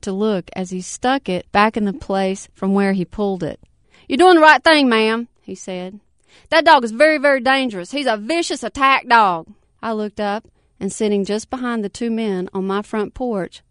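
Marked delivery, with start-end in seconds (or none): 4.1-5.3 loud approval. Acted. Man praises her loudly. 6.5-9.5 loud warning. Acted. Man warns about dog.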